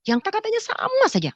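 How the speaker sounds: ordinary delivery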